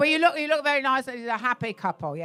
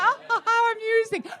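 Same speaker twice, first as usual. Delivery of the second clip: higher, slow, prim mock-polite voice; prim agreeable friend; 11 semitones higher than usual. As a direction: play the overly agreeable date, prissy and slow. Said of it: "And she's like".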